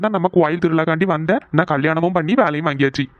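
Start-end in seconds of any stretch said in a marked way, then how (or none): none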